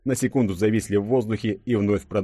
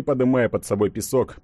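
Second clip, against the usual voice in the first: ordinary delivery, nothing marked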